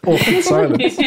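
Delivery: laughing through the words. Real, not acted. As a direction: say it while laughing at yourself. Laughing at own failed joke.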